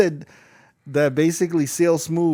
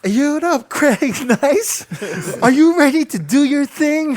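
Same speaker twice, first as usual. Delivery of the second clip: high, loud, sing-out hype impression; over-excited mock MC, pitched way up; cheerleader energy; 9 semitones higher, 6 dB louder than usual. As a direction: imitate a giddy showman hyping the stage. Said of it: "It was like that cheerleader energy".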